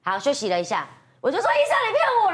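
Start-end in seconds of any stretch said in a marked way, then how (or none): 1.5-2.3 high-pitched mock wail of accusation; tearful scolding. Patient scolding doctor after painful injection, high mock-tearful protest. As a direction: act out a betrayed patient wailing at the doctor. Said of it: "这样还骂医生".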